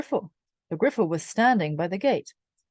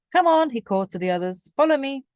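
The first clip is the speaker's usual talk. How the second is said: calling out; 6 semitones higher, 3 dB louder than usual. A narrator's reading. Calling to the others.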